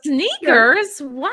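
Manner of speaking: high, rising disbelief; Sneakers? shot up high in shock. Real, not acted. Host hearing the sneaker demand, high shocked question.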